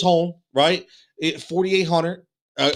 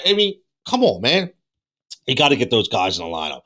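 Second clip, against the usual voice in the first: hoarse, losing his voice; 6 semitones lower than usual. Real, not acted. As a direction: voice going hoarse. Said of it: "I'm losing my voice".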